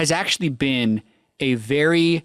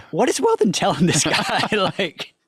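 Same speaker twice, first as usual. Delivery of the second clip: higher, laughing incredulous question; 6 semitones higher, slower than usual. Real, not acted. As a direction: crack up while asking. Incredulous quote while laughing.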